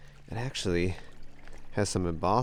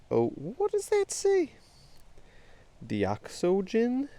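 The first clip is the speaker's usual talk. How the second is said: high, slow baffled reading; puzzled; 13 semitones higher, much slower than usual. Real, not acted. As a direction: squint at the word, confused. Puzzling over embossing.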